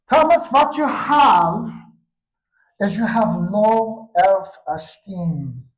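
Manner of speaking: slow, loud, mock-grave doctor; booming psychiatrist. Psychiatrist telling the boy his diagnosis, slow booming mock-solemn voice. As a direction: announce it slowly like a pompous expert.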